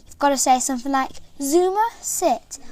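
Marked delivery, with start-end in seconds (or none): 1.4-2.6 Zuma, sit in a slow, higher American accent. Shown as a demonstration. Kid commanding her toy dog in an American accent. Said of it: "I say it with an American accent".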